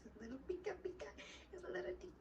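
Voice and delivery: put-on high squeaky baby-talk coo; cutesy high baby voice over the puppies. Cooing over newborn puppies.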